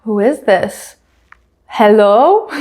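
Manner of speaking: mildly baffled mock voice; imitating someone puzzled and lost; confused. Carlos left staring at a live camera, mock confusion. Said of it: "looks so disheveled and confused".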